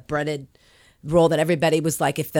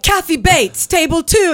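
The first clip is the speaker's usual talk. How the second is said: high, loud hostess call-out; 13 semitones higher, 6 dB louder than usual. Retold or imitated. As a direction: announce the star like big news. Coworker calls out celebrity arrival.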